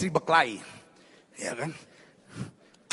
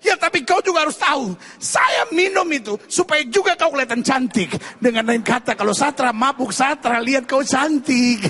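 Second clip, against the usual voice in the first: acted, raised-voice self-justification; forceful comic comeback; husband's loud retort; 4 semitones higher, 4 dB louder than usual. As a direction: argue back loudly as the husband. Husband answers weeping wife, acted.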